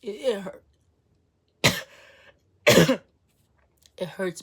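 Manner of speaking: usual speaking voice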